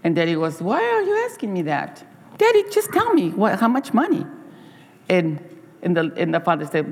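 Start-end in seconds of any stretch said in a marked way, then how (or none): 0.6-4.2 put-on high, eager little boy voice; a light childlike push for an answer; child asking. Boy pressing his tired father, high and eager.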